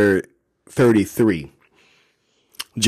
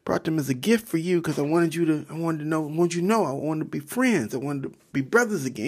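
eager Jacob; 7 semitones higher than usual. Acted. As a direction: explain the gift eagerly, pitched up. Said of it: "He's like".

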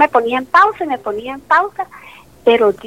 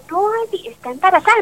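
higher, insistent tech voice; imitating lab technician; 8 semitones higher than usual. Lab tech insisting to Andy, higher mock voice. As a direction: lift the pitch to play the tech insisting.

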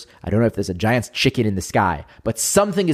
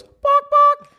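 very high, squeaky "bark, bark"; mock chicken; 5 dB louder than usual. Giant chicken on heaven's throne answering the man, very high mock bark. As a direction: squeak it out like a silly cartoon chicken. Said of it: "And the chicken goes".

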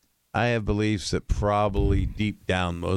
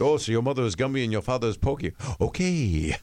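higher, put-on Robin Williams riff; Robin Williams impression; 6 semitones higher than usual. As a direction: mimic Robin teasing a young comic. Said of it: "but Robin said".

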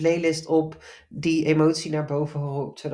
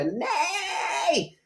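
sudden high-pitched cry of no, half laughing; a laughing inner shout of no; inner voice screaming; 7 semitones higher, 3 dB louder than usual. Retold or imitated. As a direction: let the inner voice burst out high and loud. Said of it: "alsof er iets in mij schreeuwde".

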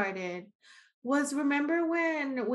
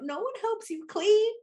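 exasperated; 11 semitones higher than usual. Retold or imitated. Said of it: "I'm like".

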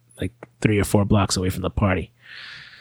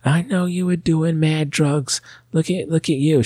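high-pitched, slow mock scolding; mother yelling; 8 semitones higher, slower than usual. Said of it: "she starts yelling at us".

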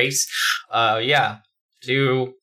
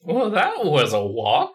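dictator voice; 6 semitones higher than usual. Acted. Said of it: "says the dictator".

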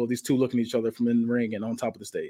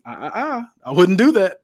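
high teasing "ah, ah, ah", then a louder warning; taunting warning; 5 semitones higher, 5 dB louder than usual. Acted. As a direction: wag a finger with your voice. Heel warning Roman off.

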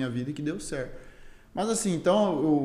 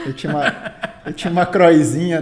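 laughing; 9 dB louder than usual. Real, not acted. Laughing about his old bike.

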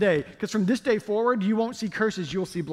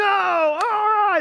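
much higher and louder, triumphant; excited cheer; 12 semitones higher, 7 dB louder than usual. Pretend. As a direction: cheer like the studio audience. Game show excitement.

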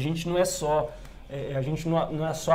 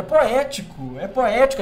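loud, high, insistent repeat; emphatic; 5 semitones higher, 5 dB louder than usual. Real, not acted. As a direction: press the point, voice raised.

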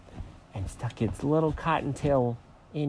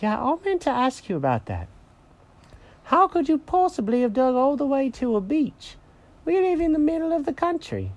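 high-pitched cat voice, incredulous; disbelieving little cartoon cat; puzzled Jenkins the cat. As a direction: question the dog's tall tale, pitched high. Jenkins questions Alfred.

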